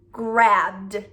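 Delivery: "grabbed" drawn out slowly to a voiced d; slow "grabbed", sounding the final d; d ending. Shown as a demonstration. Teacher showing the d sound, slow "grabbed" with voiced ending. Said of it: "it's going to say d".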